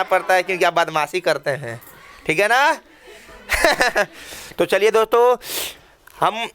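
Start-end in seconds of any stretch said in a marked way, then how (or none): 3.5-4.0 brief chuckle; short laugh. Real, not acted. Teacher joking with pupils about their mischief, short laugh. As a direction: let out a short, amused chuckle.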